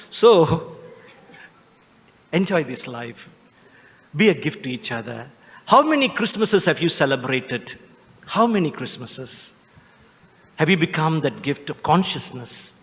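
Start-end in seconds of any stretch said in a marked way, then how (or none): none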